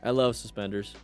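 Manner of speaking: normal talking voice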